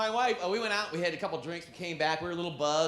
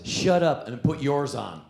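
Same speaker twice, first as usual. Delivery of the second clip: lower, slow sultry tease; sexy wife; 5 semitones lower, 3 dB louder, slower than usual. Retold or imitated. Wife turning the tables on husband, low slow sultry voice. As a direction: purr it seductively. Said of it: "looks at me all sexy".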